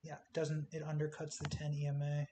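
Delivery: ordinary delivery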